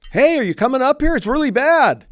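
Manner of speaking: high, loud shout to someone downstairs; calling out. Acted. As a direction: holler it from another floor of the house. Caller shouting from upstairs to realtor.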